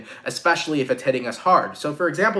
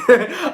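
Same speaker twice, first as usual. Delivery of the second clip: a quick burst of real laughter, higher and louder; short laugh; 9 semitones higher, 6 dB louder than usual. Real, not acted. Tarot reader laughing at her own crude toilet analogy. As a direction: laugh at the silly analogy you just made.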